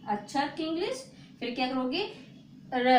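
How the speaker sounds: ordinary talk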